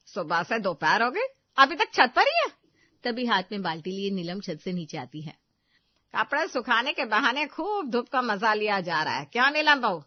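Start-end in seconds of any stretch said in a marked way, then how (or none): 0.1-2.6 louder, rising suspicious demand; sharp loud demand. Acted. Mother-in-law demands where the daughter-in-law is, loud and suspicious. 6.2-10.0 taunting. Acted. Mother-in-law taunts Neelam.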